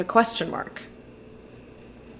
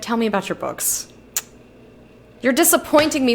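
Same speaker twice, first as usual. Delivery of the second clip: usual speaking voice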